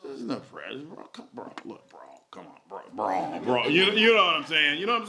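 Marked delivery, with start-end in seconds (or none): 0.0-3.7 soft, low laid-back bro talk; hushed, much lower slacker voice pleading bro; casual bro voice. Retold or imitated. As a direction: play a chill guy brushing it off. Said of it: "and then they go".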